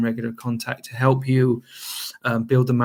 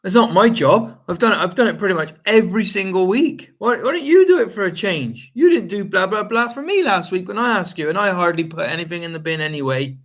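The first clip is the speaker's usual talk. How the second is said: higher and louder, indignant comeback; mock pissy argument, pitched up; defensive retort; 6 semitones higher, 3 dB louder than usual. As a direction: fire it back at the accuser. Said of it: "accuse him".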